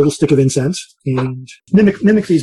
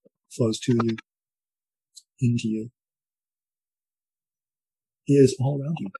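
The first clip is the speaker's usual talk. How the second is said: soft spa whisper; 6 dB quieter than usual. Acted. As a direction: ease them gently into calm. Leads a guided breathing meditation.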